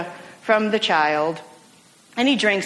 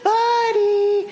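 higher, sing-song call down a long lane; owner calling her dog; 10 semitones higher than usual. Retold or imitated. Farm owner calls her runaway puppy down the lane, high sing-song call. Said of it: "She wasn't just calling that randomly".

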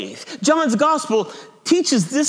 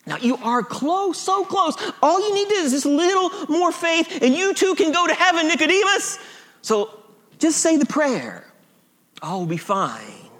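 higher-pitched mock Southern evangelical; southern accent; 5 semitones higher than usual. Evangelical Jesus coaxing Nicodemus to pray, southern accent. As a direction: coax sweetly in a put-on Southern accent. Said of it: "Jesus has a southern accent".

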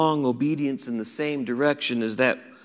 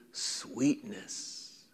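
slow and soft, savouring "sweetness"; tender; 5 semitones lower, 16 dB quieter than usual. Acted. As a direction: linger on "with sweetness" gently. Pastor savours quoted word.

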